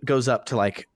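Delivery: normal talking voice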